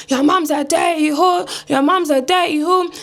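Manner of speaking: high, drawn-out mock African accent; imitated granddad with fake African accent. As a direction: taunt slowly in a put-on African accent. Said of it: "this fake yard, fake African accent".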